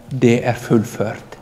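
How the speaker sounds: dying words. Acted. Jesus' last words quoted.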